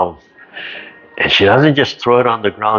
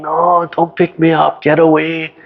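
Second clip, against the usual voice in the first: put-on much higher, half-hearted toddler tantrum; mock toddler scream; 8 semitones higher than usual. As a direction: imitate a screaming toddler refusing, lightly. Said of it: "she's just screaming".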